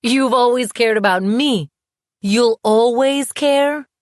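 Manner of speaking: high, loud, outraged disbelief; incredulous anger. A narrator's reading. Woman scoffing at her ex's claim to care, incredulous and angry. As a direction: throw his claim back at him, stretching the end.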